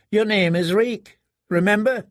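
high, mocking boy voice; taunting squire boy. A narrator's reading. Squire boy mocking the prisoner, high and teasing. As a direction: tease him like a cruel boy.

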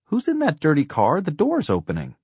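put-on high, loud little girl's voice; curious child. A narrator's reading. Daughter asks Mom about the dirty car, high and curious. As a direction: pipe up high with a child's question.

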